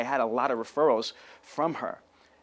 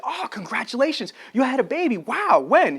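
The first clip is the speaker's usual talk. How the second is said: higher, louder, delighted congratulations; excited congratulations; 9 semitones higher, 5 dB louder than usual. Retold or imitated. Salesman delighted to hear she just had a baby. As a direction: gush over the news.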